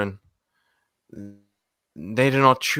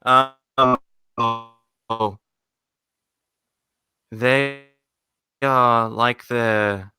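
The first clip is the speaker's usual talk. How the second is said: ordinary talk, nothing marked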